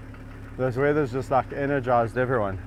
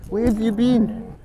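dog talk; 9 semitones higher, 3 dB louder than usual. Real, not acted. Greeting the dog, repeated.

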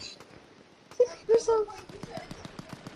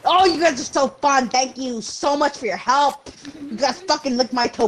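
ordinary talk

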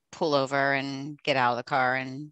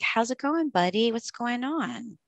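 calm, gentle check-in; 8 semitones higher, slower than usual. Pretend. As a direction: reassure the child, no alarm in the voice. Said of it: "I'm gonna be calm and I'm gonna be like".

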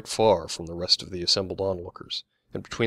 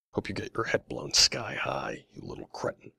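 venomous hiss; 5 semitones lower, 4 dB quieter than usual. A narrator's reading. Older man cursing the young soldier, hissing. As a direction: hiss it under your breath with contempt. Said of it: "hissed Mister Hamilton".

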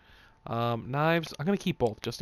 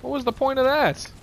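exasperated; 12 semitones higher, 8 dB louder than usual. Real, not acted. Annoyed at pointless fight.

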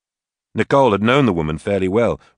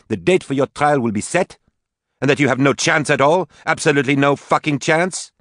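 high, loud furious warning; angry; 8 semitones higher, 4 dB louder than usual. Acted. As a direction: hammer home the threat. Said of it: "Katie said angrily".

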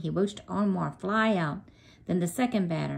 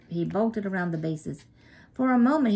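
usual speaking voice

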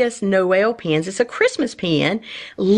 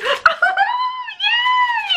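high delighted squealing laugh; excited squeal-laugh. Real, not acted. Collector opening a new pouch of pins, squealing laugh. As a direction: let out a thrilled squeal of a laugh.

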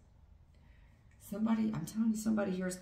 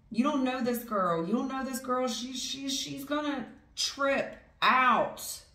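louder, higher insistent warning; a friend warning about her temper; emphatic warning; 7 semitones higher, 4 dB louder than usual. Acted. Warning about the angry female.

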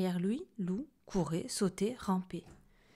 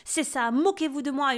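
loud, higher, furious outburst; very angry yell; 6 semitones higher, 9 dB louder than usual. A narrator's reading. Lou feeling mocked by his friends, yelling in anger. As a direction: explode at friends who seem to mock. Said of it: "hurla-t-il très en colère".

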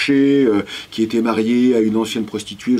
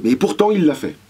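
ordinary talk, nothing marked

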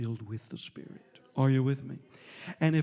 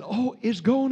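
"gone" lifted, higher and louder; excited; 9 semitones higher, 8 dB louder than usual. Retold or imitated. Healed man on the porch, amazed and excited. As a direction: realise the pain is gone, rising joy.

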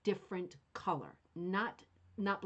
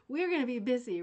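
laughing; 3 semitones higher, 5 dB louder than usual. Real, not acted. Delighted by the ideas.

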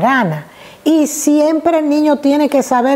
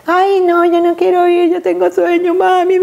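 put-on whiny reluctant girl; 7 semitones higher than usual. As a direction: beg to stay home like a sleepy girl. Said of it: "girls refusing school".

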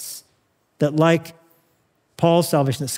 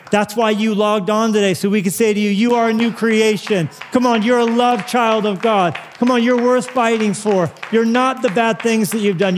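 raised, ringing sermon voice over music; preaching at full force; 6 semitones higher than usual. Acted. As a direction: preach it out to the whole congregation.

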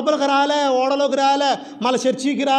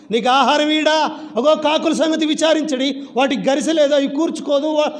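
higher-pitched, urgent sermon delivery; fervent preaching; 5 semitones higher than usual. Real, not acted. Preacher assuring believers that the Father feeds crows, fervent preaching. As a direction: press the promise home in a raised pitch.

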